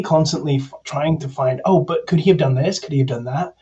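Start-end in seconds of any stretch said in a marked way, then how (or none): none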